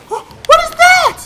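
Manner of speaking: very high, loud, slow shriek of fright; frightened shout. Real, not acted. Gamer startled by a creature in the station, frightened shriek. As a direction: scream it out in terror.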